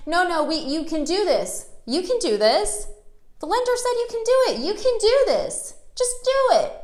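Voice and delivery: high, insistent mock salesman pushing hard; squeaky pushy sales pitch, talking over her. Pushy salesman voiced in story.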